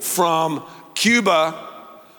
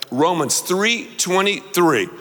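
usual speaking voice

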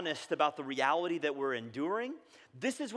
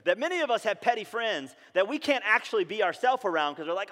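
ordinary delivery

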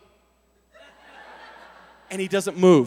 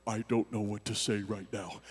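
shut down; 13 semitones lower, 11 dB quieter than usual. Retold or imitated. Person frozen in an argument, shut down. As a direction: drop deep and quiet, totally blank. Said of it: "you shut down".

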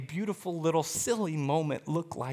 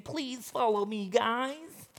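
mock pleading little plea, a bit higher and softer; petty savior; 6 semitones higher, 5 dB quieter, slower than usual. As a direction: belittle a weak savior with a lightly whiny ask. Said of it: "some petty savior saying".

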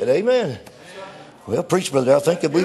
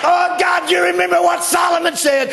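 desperate shouted prayer; 6 semitones higher than usual. Acted. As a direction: beg God from the whale's belly. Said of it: "He began to pray out to God".

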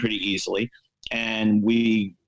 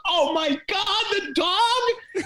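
squeaky, much higher mock horror; horrified agent; much slower than usual. Agent realises the dog is the problem, high mock horror. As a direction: throw the voice way up in hammed-up dismay. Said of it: "And he goes".

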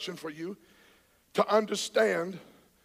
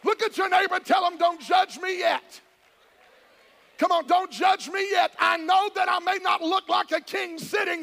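higher and louder, fired up; excited preaching; 8 semitones higher, 4 dB louder than usual. Real, not acted. Pastor getting worked up urging the congregation, excited. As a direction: let the excitement carry you up. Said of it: "I'm getting excited right here".

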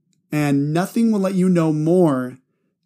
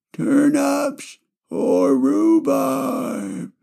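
drawn-out grandpa voice; 10 semitones higher, much slower than usual. Acted. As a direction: stretch "turnips or rhubarb" out like a stuck grandpa. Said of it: "I just love doing my grandpa voice".

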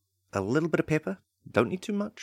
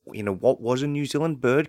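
usual speaking voice

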